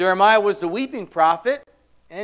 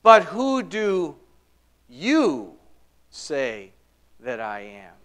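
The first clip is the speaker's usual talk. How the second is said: "you" set apart by pauses; stress on "you"; 4 semitones lower, much slower than usual. Retold or imitated. Jesus asking his disciples personally, "you" stressed and isolated. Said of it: "hear him emphasizing the word you".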